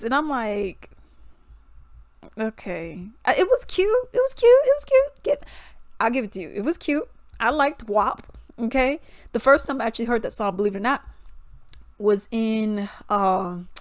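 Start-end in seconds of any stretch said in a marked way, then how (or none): none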